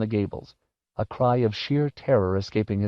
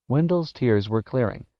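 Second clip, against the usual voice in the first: ordinary delivery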